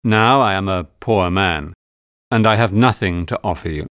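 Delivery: lightly voiced gentleman, a touch of sadness; understated sadness. A narrator's reading. Fogg telling Aouda he is now poor, mildly sad. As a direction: confess it with restrained regret. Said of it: "he began sadly".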